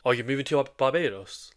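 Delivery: ordinary talk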